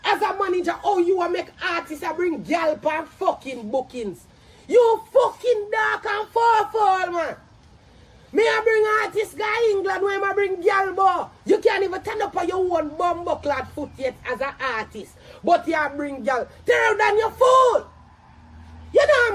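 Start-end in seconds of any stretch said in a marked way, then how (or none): 4.7-7.4 high, slow, shouted insult; real angry shouting; furious insult. Real, not acted. Insults the manager angrily. 16.7-19.3 exasperated full-voiced outburst; furious insult. Real, not acted. Insults the manager angrily.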